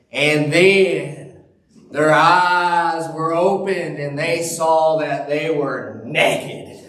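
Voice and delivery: slow, weighty "naked" with a laugh breaking in; mock-solemn reader stressing "naked"; emphasis on naked. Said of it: "he just emphasized that word increasingly".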